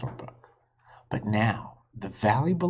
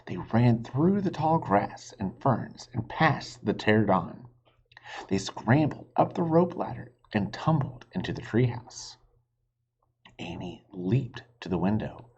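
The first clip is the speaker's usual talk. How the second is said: ordinary delivery, nothing marked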